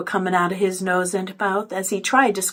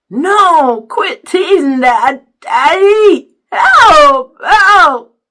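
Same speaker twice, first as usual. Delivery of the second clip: loud, high garbled protest; muffled wailing; 7 semitones higher, 12 dB louder, much slower than usual. A narrator's reading. Byron begs teasing dad for help, wailing. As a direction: plead through stuck lips.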